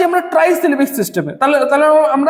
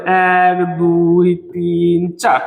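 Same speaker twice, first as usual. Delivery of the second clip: slow, low, level count; drawn-out counting; 7 semitones lower, much slower than usual. Shown as a demonstration. Teacher counting metres for the class, slow and droning. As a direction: stretch each number on one low note.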